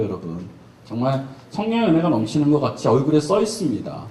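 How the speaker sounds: ordinary talk, nothing marked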